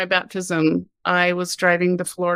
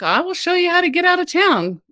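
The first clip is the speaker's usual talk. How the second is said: bold challenge; 10 semitones higher than usual. Retold or imitated. Bold challenge to rude guy.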